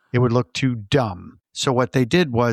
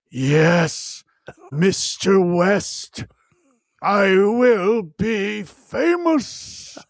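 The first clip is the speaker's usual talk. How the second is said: higher, very slow, drawn-out villain voice; vampire villain; 5 semitones higher, much slower than usual. Retold or imitated. Host mocking Doctor Hill's sudden villain voice, slow and high. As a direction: ham it up like a movie vampire gloating. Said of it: "Where is this voice coming from?".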